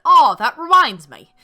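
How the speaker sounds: loud, slow, vaguely Cockney Irish accent. Shown as a demonstration. Shirted soldier remembers something, in vaguely Cockney Irish accent. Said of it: "I need to do the Irish, vaguely Cockney accent".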